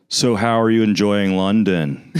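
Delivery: slow, drawn-out mock American tourist. Host imitating Americans met in London, mock tourist voice. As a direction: play a slow-talking American abroad. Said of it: "they're always saying something like".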